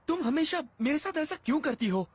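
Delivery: high, aggrieved whine; exasperated high-voiced protest; whining complaint. Complaining after being teased.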